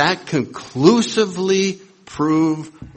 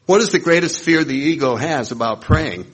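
usual speaking voice

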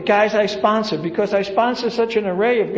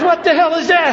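startled newcomer; 6 semitones higher, 5 dB louder than usual. Retold or imitated.

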